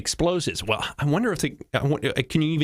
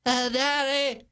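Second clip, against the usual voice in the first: put-on high-pitched little kid voice; little boy; 11 semitones higher, slower than usual. Nine-year-old runs up to dad, little boy voice. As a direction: call out in a high child's voice. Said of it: "and he runs up".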